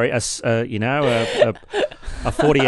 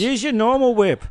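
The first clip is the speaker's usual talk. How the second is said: mother voice; 10 semitones higher, 4 dB louder than usual. Pretend. Playing the mother answering.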